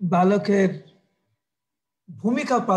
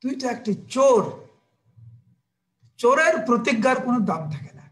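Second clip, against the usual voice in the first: slightly raised, stern accusing voice; a hint of an angry mother; scolding mother; 3 semitones higher than usual. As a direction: scold a child caught stealing. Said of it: "মা যশোদা এরকম করে কৃষ্ণকে বলছে".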